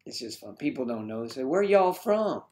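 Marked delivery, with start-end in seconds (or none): 1.4-2.4 put-on high, slow Southern accent. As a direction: ask it in a drawn-out Southern accent. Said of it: "break into an accent".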